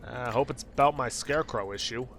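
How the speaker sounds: ordinary talk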